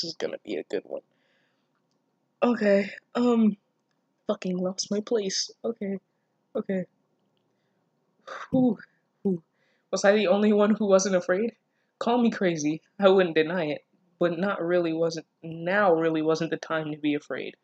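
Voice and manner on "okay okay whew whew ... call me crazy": normal talking voice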